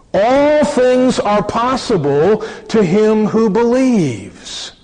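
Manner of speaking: slow, high, loud and full declaration; solemn proclamation. Acted. Preacher voicing Jesus to the crowd, slow and ringing. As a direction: proclaim it as a promise to the whole crowd.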